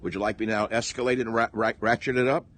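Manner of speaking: normal talking voice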